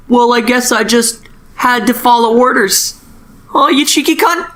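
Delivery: high, put-on cheeky voice; mock high voice. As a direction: pipe it up high like a smug kid. Tobias answers, voiced in retelling.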